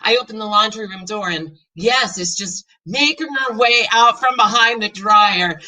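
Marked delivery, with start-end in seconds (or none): none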